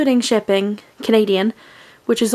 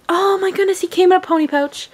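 much higher, bursting with joy and speeding up; real excitement; 9 semitones higher, faster than usual. Real, not acted. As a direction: squeal with delight at the surprise. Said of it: "so excited".

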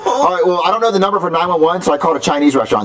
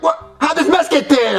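high-pitched mock bafflement; imitating baffled cook; 11 semitones higher than usual. Son predicting cook's reaction, mock baffled voice. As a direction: mimic a clueless reaction, pitched up. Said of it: "be like".

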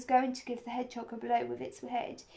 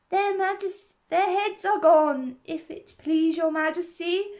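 loud, higher soldier's report; shouted reply; 6 semitones higher, 9 dB louder than usual. A narrator's reading. Soldiers report to the Queen, shouting in reply. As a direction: call it out loudly to royalty. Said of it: "the soldier shouted in reply".

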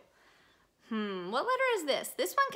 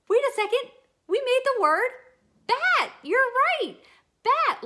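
thrilled, high and loud; excited; 10 semitones higher, 7 dB louder than usual. Acted. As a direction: gasp and celebrate the discovery. Excited discovery of word.

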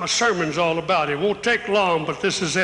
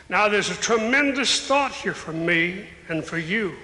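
ordinary talk, nothing marked

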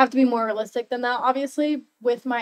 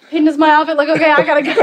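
loud, higher, rushed teasing impression; hurried dad imitation; 5 semitones higher, 12 dB louder, faster than usual. Daughter imitating dad checking his outfit, loud and rushed. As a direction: call it out fast and loud, amused. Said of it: "you come out and you're like".

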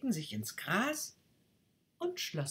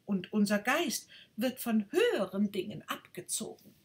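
higher, grand self-important voice; boastful rocket; 6 semitones higher, 4 dB louder than usual. Acted. Rocket brags to the duck about its family, higher and grand. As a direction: brag like royalty.

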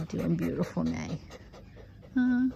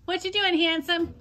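much higher sing-song greeting to a pet; high cooing pet voice; 10 semitones higher than usual. Real, not acted. Cooing to the dog.

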